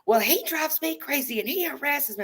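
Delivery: high-pitched mock rant about the tenant; lightly voiced, whiny complaint; mock hollering landlady. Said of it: "she started hollering about him".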